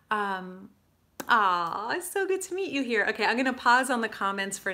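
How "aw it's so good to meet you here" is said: delighted greeting. Real, not acted. Greeting a viewer.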